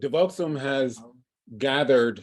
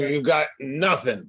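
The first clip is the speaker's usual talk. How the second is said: ordinary talk, nothing marked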